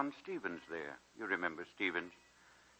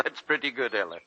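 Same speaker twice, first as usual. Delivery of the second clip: ordinary talk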